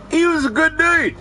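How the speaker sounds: high, loud ironic praise; deadpan irony. Real, not acted. Telling friends about a murderer cellmate, high mock praise. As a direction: oversell that he was good. Killer called a good dude.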